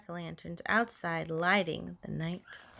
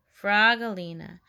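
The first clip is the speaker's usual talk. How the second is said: plain reading voice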